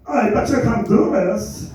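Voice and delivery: high-pitched, hammed-up German accent; put-on cartoonish German accent; bad German accent. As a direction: imitate a German neighbor, badly and high. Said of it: "I would do a German accent, but I suck at it".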